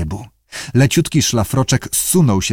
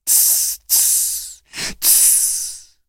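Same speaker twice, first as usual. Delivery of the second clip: soft voiceless "ts" hisses; creature's hiss; 9 dB quieter, much slower than usual. Acted. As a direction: imitate sea creatures' quiet tsk-like hissing. Głosy stworzeń z morza. (ciche syczenie morskich stworów)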